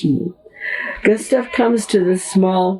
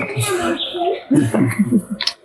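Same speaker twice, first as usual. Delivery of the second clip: high, breathy little laugh; light laugh; 12 semitones higher than usual. Real, not acted. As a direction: laugh at the stubborn ants. Amused by ants.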